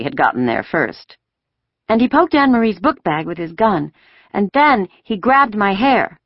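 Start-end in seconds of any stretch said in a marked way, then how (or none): 1.9-6.2 put-on higher-pitched child telling a tale; chattering small child. A narrator's reading. Five-year-old telling mother about soldiers, chattering. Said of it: "Kirsty was chattering".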